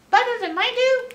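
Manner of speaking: ordinary delivery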